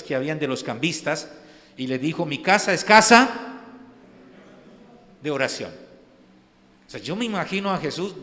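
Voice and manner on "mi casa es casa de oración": forceful rebuke. Retold or imitated. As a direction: thunder it at the money changers. Jesus clearing the temple.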